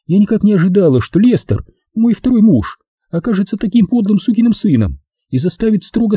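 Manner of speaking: high-pitched woman airing a grievance; high, aggrieved actress; Maxine, confiding. Acted. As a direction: confide your troubles in a high female voice.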